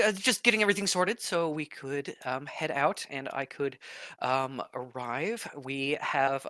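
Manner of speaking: proper, gracious cleric; prim priestess voice. Acted. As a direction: speak like a dignified priestess.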